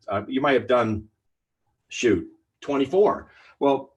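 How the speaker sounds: normal talking voice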